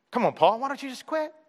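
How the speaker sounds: high, soft mocking taunt; a high, hushed taunt urging Paul to quit. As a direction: tease him to quit. Mock tempter taunting Paul.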